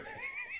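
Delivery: sudden high-pitched laugh; high burst of laughter. Real, not acted. Host cracking up at his play count, high laugh. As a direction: break into a squealing laugh.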